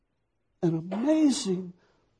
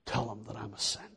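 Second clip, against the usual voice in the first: whisper; 6 semitones lower, 12 dB quieter than usual. Acted.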